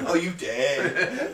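put-on slow, laughing soft Southern accent. Agreeing with cohost, mimics the preacher in a slow Southern accent. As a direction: drawl it like a soft-spoken Southerner. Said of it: "He sounds like".

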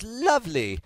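British announcer's "lovely"; British accent. Shown as a demonstration. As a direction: put on a British lilt for "lovely". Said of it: "But in, with a British accent, it sounds".

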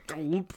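"gulp" said as a swallowed sound; exaggerated gulp. Teasing co-host's hard G, "gulp" gulped out. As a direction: swallow the word "gulp" theatrically.

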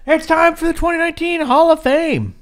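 high, loud ring-announcer call. Pretend. Podcast host introducing the Hall of Fame segment, announcer voice. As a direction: proclaim it big to the crowd.

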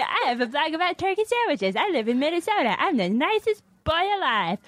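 high-pitched coworker impression; Nick Hansen. Coworker boasts of turkey sandwich blog, high impression. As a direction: introduce yourself as a guileless nice boy, voice way up. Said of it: "Nick Hansen. He's like".

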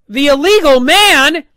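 high, loud disbelieving repeat; incredulous echo. Real, not acted. Reviewer mocking the commentators' excuse, incredulous. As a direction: throw the phrase back in disbelief. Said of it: "mocking disbelief".

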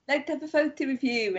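mock Russian tourist. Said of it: "They're just like".

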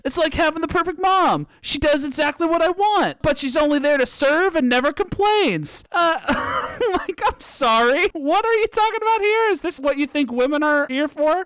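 high, incredulous outrage; indignant. Real, not acted. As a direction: rise up in disbelief at them. Outraged feminist rant.

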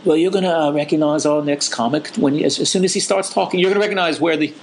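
mock British MC introducing the next comic, in a poor English accent; a deliberately terrible English accent; bad English accent. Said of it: "That was a terrible English accent".

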